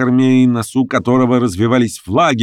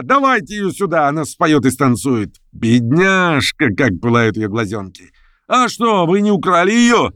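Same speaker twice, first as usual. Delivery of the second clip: much higher, playful theatrical voice; teasing carnival reveller; 8 semitones higher than usual. Acted. Reveller in red coat jokes about the girl, playful and teasing. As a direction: tease the strangers like a drunk masquerader.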